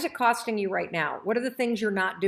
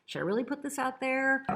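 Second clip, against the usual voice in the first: higher, hesitant self-doubting voice; doubting inner voice; 4 semitones higher, 4 dB quieter, slower than usual. Retold or imitated. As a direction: voice the nagging doubt before you post. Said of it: "and you're like".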